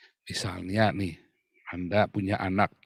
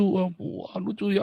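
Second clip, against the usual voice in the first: high, cooing mock amusement; mock amused parent; 5 semitones higher, 3 dB quieter, slower than usual. Parent laughing off the child's mishap, mock amused. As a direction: imitate a parent finding it cute. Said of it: "Jangan malah ketawa".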